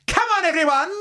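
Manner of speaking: high, rousing shout; rally the survivors; rallying cry. Acted. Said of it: "you hear her say".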